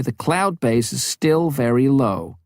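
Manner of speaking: lightly voiced woman answering over the radio; radio room reporting to the pilot, lightly voiced woman; Norma on the radio. A narrator's reading.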